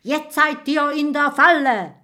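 triumphant call. A narrator's reading. Ghost head tells the fugitives they are trapped, calling out high. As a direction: proclaim it high like a spook. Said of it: "und ruft".

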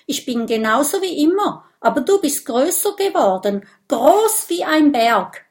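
affronted little dragon talking back; indignant retort. A narrator's reading. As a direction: rattle it off offended. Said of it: "antwortet Minimo empört".

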